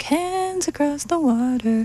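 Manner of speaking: high, slow, muffled crooning. Retold or imitated. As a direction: sing it through a voice muffler. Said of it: "like, muffled kind of voice thing".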